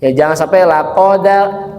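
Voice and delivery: ordinary delivery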